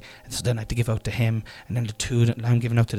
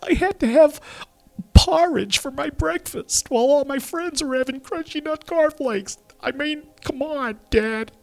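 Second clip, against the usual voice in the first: higher whining voice of a grown son; imagined adult son complaining about dad's affirmations, higher and aggrieved; future grown son; 12 semitones higher than usual.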